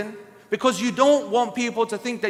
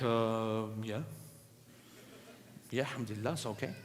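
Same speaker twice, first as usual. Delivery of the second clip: much lower, much softer hesitant answer; awkward reluctant reply; 12 semitones lower, 16 dB quieter than usual. Retold or imitated. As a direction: mumble it, uncomfortable and cornered.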